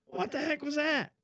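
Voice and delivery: hammed-up furious boss; angry boss. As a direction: demand an answer. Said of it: "And he goes".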